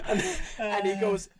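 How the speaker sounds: ordinary talk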